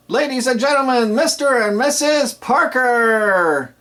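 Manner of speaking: high, loud, slowly stretched announcement; enthusiastic build-up. Shown as a demonstration. DJ announcing the couple after their first dance, hyped. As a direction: drag out the names so the crowd claps. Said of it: "it has enthusiasm there".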